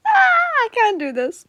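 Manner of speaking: giggly exasperated wail; laughing cry. Real, not acted. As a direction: whine it out while cracking up. Giggling, cracking up in bloopers.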